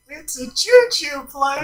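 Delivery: high, slow silly voice; silly cartoon voice. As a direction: say it like a goofy cartoon. Silly quote voice.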